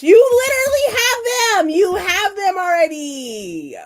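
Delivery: high, loud mock outrage; loud, high-pitched playful complaint; playful indignant yell. Real, not acted. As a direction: protest loudly, half laughing. Playful protest at chat.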